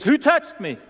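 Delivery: high-pitched, sudden question, acted out; startled demand. Jesus stops and questions the crowd, startled. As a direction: call out the question, pitched well up.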